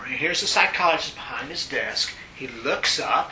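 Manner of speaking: ordinary delivery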